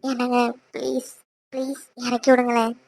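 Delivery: low, hushed coaxing; small, quiet plea; soft pleading. Acted. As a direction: beg your husband softly. Wife begs to be put down.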